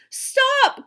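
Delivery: very high, loud shriek to stop; sharp high-pitched shout; desperate cry. A narrator's reading. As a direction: cry out in horror. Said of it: "cried the princess".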